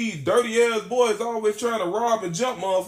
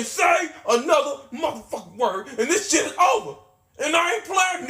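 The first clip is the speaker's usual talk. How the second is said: threatening shout; 6 semitones higher than usual. Retold or imitated. Threatening Trouble at gunpoint with a shout. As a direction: yell the threat straight in his face.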